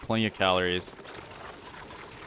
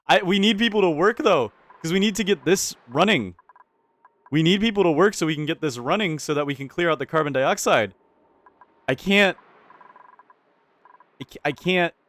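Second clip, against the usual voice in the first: frantic; 7 semitones higher, 4 dB louder than usual. Real, not acted. Frantic plea for workers.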